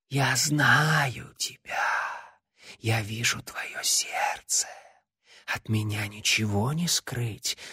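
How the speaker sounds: slow eerie whisper; whispering shadow. Acted. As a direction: hiss it like living darkness. The shadow speaks to Larry.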